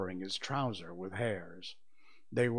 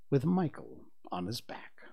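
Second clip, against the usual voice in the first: plain reading voice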